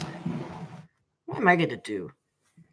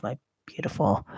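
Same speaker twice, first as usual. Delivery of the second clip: ordinary talk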